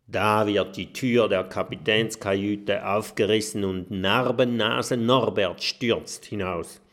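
ordinary delivery

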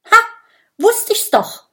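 high, loud triumphant cry; gleeful exclamation; joyful call. A narrator's reading. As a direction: crow with delight at being right. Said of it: "ruft Minimo freudig".

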